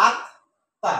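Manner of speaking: আত, a clear pause, then তা; আত set apart from তা as in dictation; syllable by syllable. Shown as a demonstration. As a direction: keep আত and তা as separate beats. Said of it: "এভাবে টানটা দিতে পারি".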